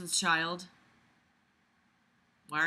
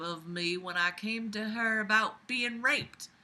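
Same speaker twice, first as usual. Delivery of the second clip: put-on confessional Southern belle accent; Abby, Southern belle. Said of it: "my Southern Belle accent".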